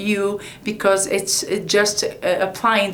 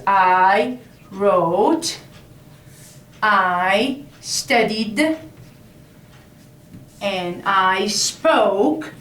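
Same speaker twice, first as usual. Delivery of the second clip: slow teacher demo; much slower than usual. Shown as a demonstration. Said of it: "Okay, so this is for".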